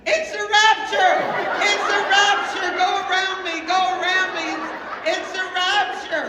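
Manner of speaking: high, frantic yell at other drivers; screaming and hollering. Retold or imitated. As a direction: holler a panicked warning out of the car. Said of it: "We're screaming and hollering".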